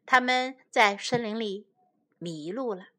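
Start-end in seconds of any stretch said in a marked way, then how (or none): none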